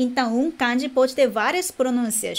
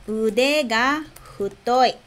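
"Ude ga futoi" slowly, word by word; slow language-teacher repetition with small gaps; slow model phrase; slower than usual. Shown as a demonstration. Teacher models "braço gordo" for learners, slowly.

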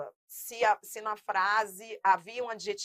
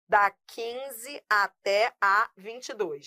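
"Da quinze até a vinte e dois" spoken slowly and clearly; slow instruction; 4 dB louder, much slower than usual. Shown as a demonstration. Teacher assigning exercises to students, slow and clear. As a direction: spell out the range slowly. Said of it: "Usando essa lógica".

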